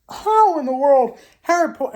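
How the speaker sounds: exasperated wail over the defense; exasperated cry. Real, not acted. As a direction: wail it like the loss hurts.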